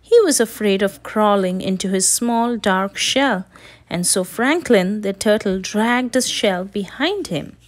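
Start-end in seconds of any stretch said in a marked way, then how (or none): none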